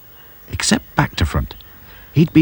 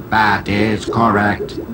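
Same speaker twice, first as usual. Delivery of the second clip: slow, even machine voice; robot voice; much slower than usual. A narrator's reading. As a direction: speak like a cold machine. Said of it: "answered the robot".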